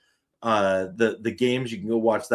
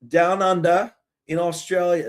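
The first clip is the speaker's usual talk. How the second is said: badly faked Australian accent, pitched up; terrible Aussie accent; 6 semitones higher than usual. Streamer starting the season preview down under, hammy Aussie accent. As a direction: have a half-hearted go at Aussie. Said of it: "That's a terrible Aus-, Aussie accent".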